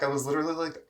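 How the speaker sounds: normal talking voice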